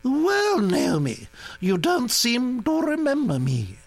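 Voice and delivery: put-on high, brisk aristocratic old lady; old duchess voice. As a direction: gossip like an imperious old duchess. Duchess greets Naomi.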